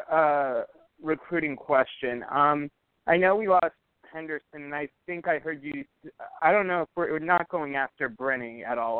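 ordinary talk

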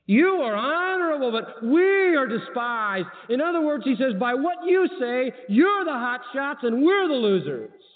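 high, slow mocking contrast; sarcastic. Preacher voicing Paul's sarcasm, high and mocking. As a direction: sneer at their pride. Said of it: "watch how sarcastic he gets".

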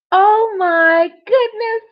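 high, slow, drawn out shock; shocked gasp. Acted. Hardboard reacting to the doctor's ear, shocked. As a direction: gasp it out in horror.

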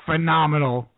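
ordinary talk

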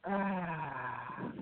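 long soft exhaled ah; sighing ahh. Shown as a demonstration. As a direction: let the breath out on a soft ah. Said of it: "I usually make a sound of ah".

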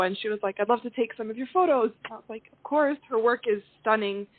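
ordinary talk, nothing marked

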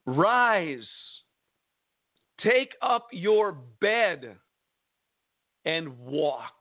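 very slow command with long pauses; solemn command. Acted. Jesus commands the sick man to walk, solemn. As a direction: give each phrase weight, falling on the last.

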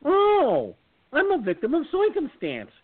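high, loud silly cartoon voice; high mock voice. Voter to laughing crowd, high goofy cartoon voice. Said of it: "And I went".

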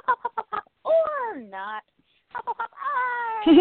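high, slowed, dithering bird voice; hesitant bird. Pretend. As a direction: voice the bird's timid hops.

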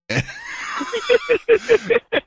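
big high laugh that bursts out. Real, not acted. Telling the story to his friend, a real high burst of laughter.

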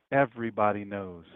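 usual speaking voice

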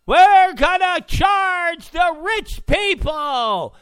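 high, slow, shouted campaign mimicry; mock Hillary. Host mimicking Hillary at a rally, high and slow. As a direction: ham up a pompous stump speech. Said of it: "Hillary Clinton".

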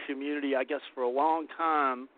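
ordinary delivery

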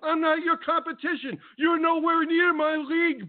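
high, indignant ranting at a rival; scornful high-pitched tirade; heated rant. Real, not acted. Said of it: "I'm done with my rant".

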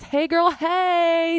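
high, drawn-out singsong greeting; playful calling out. Pretend. Host hails Sarah in case she listens, playful singsong call. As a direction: call out playfully, stretching each word high.

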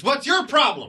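high-pitched mock exasperation; snapping wife. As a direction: imitate a mom snapping at the other kid. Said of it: "She goes".

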